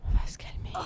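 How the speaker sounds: soft whispered calm down; hushed self-soothing whisper. Real, not acted. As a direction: whisper it to yourself to settle. Same words whispered.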